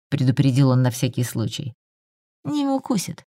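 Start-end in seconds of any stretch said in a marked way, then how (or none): 2.4-3.2 put-on indifferent, high and soft child reply; равнодушно. A narrator's reading. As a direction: shrug it off, a high quiet child voice. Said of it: "равнодушно возразил Стасик". (равнодушно, тонким тихим голосом)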